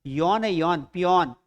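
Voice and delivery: यौन as "yon", then the whole पियोन; syllable demonstration. Shown as a demonstration. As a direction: open o in यौन, not oo. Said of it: "यू की मात्रा नहीं है".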